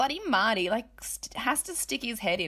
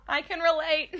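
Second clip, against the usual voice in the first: high, giggly voice through laughter; real laughter taking over the words; laughing; 8 semitones higher than usual. Real, not acted. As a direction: squeeze the words out while laughing. Laughing at her own anecdote.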